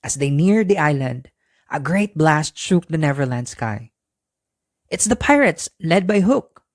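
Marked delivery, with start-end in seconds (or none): 4.9-6.6 high alarmed cry; cried out. Acted. Peter warning the children of the pirates, alarmed. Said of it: "cried Peter".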